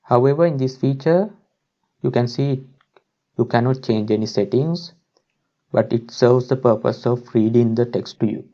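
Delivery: ordinary talk, nothing marked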